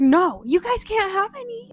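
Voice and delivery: loud, high mock yell; bratty refusal. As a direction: act out a big sister refusing her siblings. Retelling refusing her little siblings.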